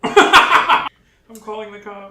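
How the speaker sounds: laughing loudly at the premise. Real, not acted. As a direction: burst out laughing. Mock alarm at gangster hangout.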